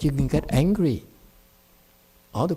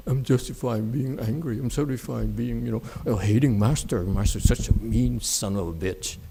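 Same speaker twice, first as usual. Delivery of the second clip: muttered mock complaint; 6 semitones lower, 6 dB quieter than usual.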